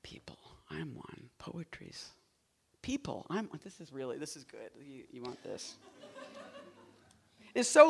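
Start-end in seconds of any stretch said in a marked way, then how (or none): 0.0-5.8 much softer, breathy poetic whisper. Acted. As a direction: murmur the lines like a secret. Hushed poem reading to audience.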